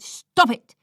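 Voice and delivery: shout. A narrator's reading. Carer grabbing Lloyd to stop the fight, shouting. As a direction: raise the voice to halt them.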